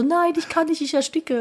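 high, strained choking burglar; suffocating burglar. Pretend. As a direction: gasp under the fat cat. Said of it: "Und der Einbrecher so".

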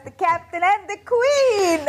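slow, high showy announcer. Acted. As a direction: present the show title grandly. Show title announced. (langsame Ansagerstimme)

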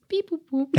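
high beeping robot noises; robot beeps. Pretend. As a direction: beep like a little toy robot. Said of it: "Ich bin ein Roboter".

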